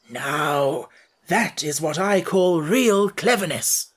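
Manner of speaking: slow, pleased praise; approving. A narrator's reading. Scarecrow praising the Wogglebug's idea, slow and approving. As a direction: savour the compliment. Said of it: "said the Scarecrow approvingly".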